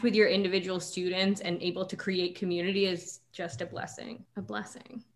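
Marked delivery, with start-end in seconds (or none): 2.9-5.0 hushed. Real, not acted. Soft reverent closing remark.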